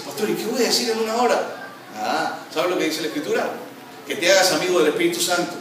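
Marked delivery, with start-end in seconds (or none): 0.0-1.7 puzzled churchgoer. Pretend.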